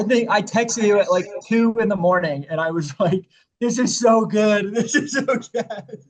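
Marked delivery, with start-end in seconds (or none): 3.6-6.0 slow, high, laughing gush. Retold or imitated. Re-enacting a two a.m. text, gushing. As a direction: draw it out, higher and louder, tickled.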